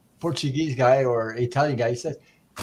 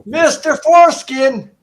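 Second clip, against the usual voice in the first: high, loud, drawn-out call of a name; imitating a clerk shouting for the next person; yelled-out name call; 12 semitones higher, 11 dB louder, slower than usual. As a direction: yell the name out across a waiting room. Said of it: "and she yells out".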